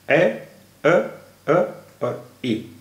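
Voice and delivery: ए, अ, अ, ई as isolated sounds with short pauses; separated vowel sounds. Shown as a demonstration. Teacher breaking accurately into four vowels, separated vowel sounds. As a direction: sound out ए, अ, अ, ई one by one. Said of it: "चार vowel sounds हैं".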